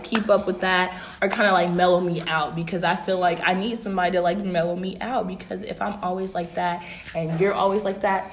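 normal talking voice

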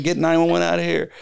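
high-pitched mock yell; retold urgent call. Injured installer to panicking bystander, high urgent demand.

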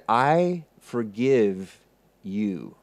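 solemn forgiveness. Retold or imitated. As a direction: let each word of forgiveness sink in. Forgiveness repeated slowly for weight.

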